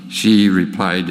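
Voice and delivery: ordinary talk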